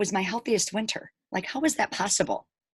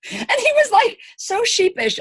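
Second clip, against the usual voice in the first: laughing; 14 semitones higher, 4 dB louder than usual. Real, not acted. Laughs recalling sheepish husband.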